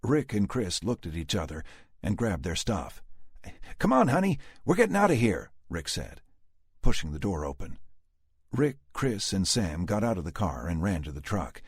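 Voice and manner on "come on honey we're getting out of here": urgent father. A narrator's reading. Father urging daughter to flee.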